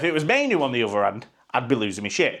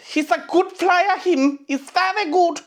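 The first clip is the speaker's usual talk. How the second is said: much higher, slow put-on voice of an awed opponent; mock rival player. Rival player after facing Bruno, mock awed high voice. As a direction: squeak out grudging praise like a stunned opponent.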